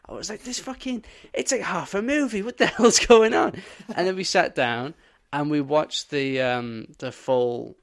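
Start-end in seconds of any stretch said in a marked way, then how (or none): 0.4-3.5 high-pitched mock rant, ending louder; retold exasperation. Complaining about half a movie, high exasperated rant. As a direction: rant like a cheated viewer. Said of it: "I was so pissed off".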